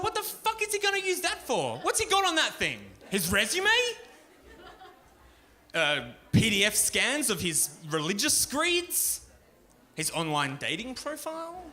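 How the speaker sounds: disbelieving thought blurted out high; incredulous. Retold or imitated. Comic baffled by homeless man's flash drive, high incredulous.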